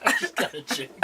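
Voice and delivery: soft, amused echo through laughter; quiet giggly echo of a silly line; laughing repeat. Real, not acted. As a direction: repeat it while chuckling.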